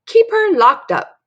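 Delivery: high, loud, slow villain voice; put-on scornful old woman; Missus Gobblecracker grumbling. Villain agrees with mom over popcorn, grumbling. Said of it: "grumbled Missus Go".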